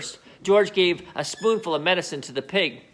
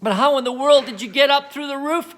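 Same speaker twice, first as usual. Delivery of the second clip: high astonished cry; cried; 8 semitones higher than usual. Acted. The mother crying up at Grandma on the roof, high. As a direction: cry out in disbelief. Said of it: "cried the grandmother".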